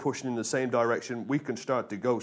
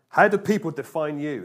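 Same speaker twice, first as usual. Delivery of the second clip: higher, louder challenge; emphatic question; 4 semitones higher, 8 dB louder than usual. Shown as a demonstration. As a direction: put the question to them boldly. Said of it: "It's a question to ask yourself".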